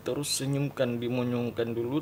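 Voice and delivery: normal talking voice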